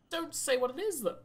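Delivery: high whiny plea. Acted. Begging game not to hide item.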